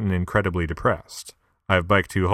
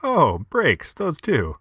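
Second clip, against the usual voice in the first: alarmed realization; 9 semitones higher, slower than usual. Retold or imitated. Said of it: "Followed soon thereafter by".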